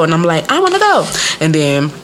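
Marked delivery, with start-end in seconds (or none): 0.5-1.1 put-on high-pitched little-girl plea; pleading child. Little girl begging her mother to go out, pleading. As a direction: whine to be allowed along, high.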